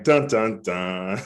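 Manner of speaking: hummed dramatic sting, falling away; mock-ominous movie sting; dun dun dun. After losing his job, a mock dramatic sting.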